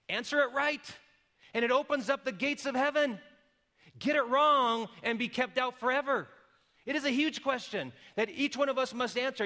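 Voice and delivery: urgent preaching. Real, not acted. Preacher pressing the big question.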